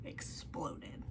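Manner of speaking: dramatic whisper on the last word; whispered reveal. Acted. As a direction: breathe the word out, almost no voice. Dramatic payoff of the story.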